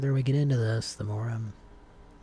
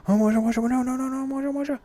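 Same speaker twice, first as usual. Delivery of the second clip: very high, loud panicked character voice; scared Moja; 7 dB louder, much faster than usual. Acted. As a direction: squeal it in a frantic, pleading panic. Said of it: "trying to make Moja sound really scared".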